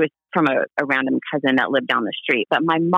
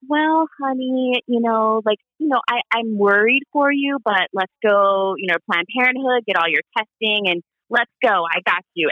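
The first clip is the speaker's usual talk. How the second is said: higher, soothing take on mom; imitating mom's gentle reply; 6 semitones higher than usual. Daughter voicing her supportive mom, higher and soothing. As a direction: play the loving mom calming a wild idea. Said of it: "My mom would be like".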